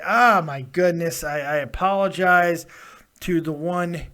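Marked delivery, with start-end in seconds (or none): none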